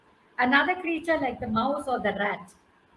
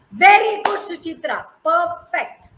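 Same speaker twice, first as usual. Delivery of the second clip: excited praise; 4 semitones higher, 9 dB louder than usual. Real, not acted. Teacher praising student.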